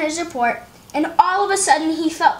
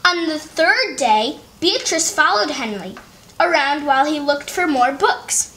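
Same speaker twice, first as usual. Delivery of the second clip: ordinary delivery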